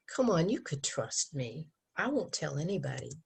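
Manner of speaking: soft, sweet-talking coax. Retold or imitated. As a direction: murmur it like a smooth persuader. Teacher voicing sweet-talking pressure.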